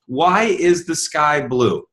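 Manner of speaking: a slow, drawn-out question. Shown as a demonstration. Posing a slow question to the audience. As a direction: ask it slowly, like a quiz. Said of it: "I'm gonna ask you a question".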